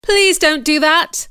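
high, loud, slow plea; pleading. Acted. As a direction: beg desperately. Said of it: "I cried".

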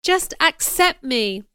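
insistent, impassioned appeal; crying out. A narrator's reading. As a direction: press for an answer emphatically. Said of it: "Mister Rochester cried".

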